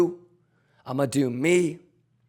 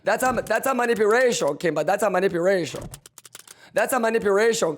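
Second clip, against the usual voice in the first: hyped taunt; 5 semitones higher, 4 dB louder, faster than usual. Real, not acted.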